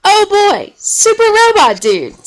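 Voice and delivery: high, loud, slow cry of delight; delighted kid. A narrator's reading. Henry opening Grandma's present, high delighted cry. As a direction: gasp it out like a dream come true. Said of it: "said Henry".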